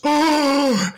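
very high, shocked oh. Retold or imitated. Host hears the forecast and gives a very high shocked oh. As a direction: squeak out a stunned oh. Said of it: "Bill Fleckenstein goes".

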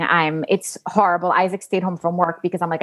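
ordinary talk, nothing marked

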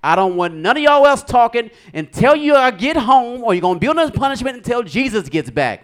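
high, loud scolding mom voice; upset mom. Retold or imitated. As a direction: lay down the law as an exasperated mother. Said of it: "My mom is upset".

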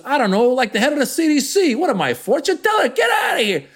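high, shouting New York Italian mobster; Italian mob boss. Acted. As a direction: wave it off in an exasperated Italian mob accent. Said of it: "So now I'm doing the Italian guy".

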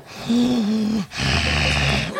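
long mock snore; drawn-out comic snoring breath; imitated snoring. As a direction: snore like someone dozing off in prayer. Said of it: "like he's snoring on his knees".

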